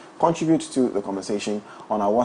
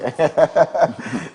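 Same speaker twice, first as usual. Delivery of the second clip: higher chuckle; teasing laugh; 4 semitones higher than usual. Real, not acted. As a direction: laugh at catching someone out. Teasing co-host about sitting straight.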